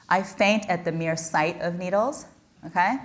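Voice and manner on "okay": ordinary talk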